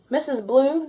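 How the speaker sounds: high, loud call; eager announcement. Acted. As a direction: call out proudly. Princesses announce to housekeeper.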